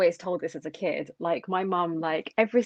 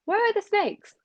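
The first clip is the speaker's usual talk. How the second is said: put-on incredulous child; 8 semitones higher, 4 dB louder than usual. Retelling confused reply to mum.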